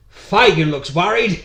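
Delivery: put-on loud, slow, broad Australian accent; hammed-up Australian reading of a Cockney line. Actor replaying his failed Cockney Bill Sikes, broad Australian accent. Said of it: "an Australian accent 'cause at the time".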